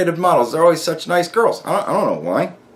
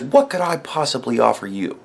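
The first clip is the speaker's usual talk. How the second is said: mock sultry elf queen; 6 semitones lower, 5 dB quieter than usual. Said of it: "looks at you and says".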